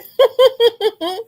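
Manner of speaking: bursting into high laughter; real, loud cackle. Real, not acted. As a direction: laugh out hard. Laughing at a viewer comment.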